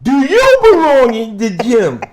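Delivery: hammed-up defiant gym rat; Stonewall's voice, ranting. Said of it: "That's how he talks".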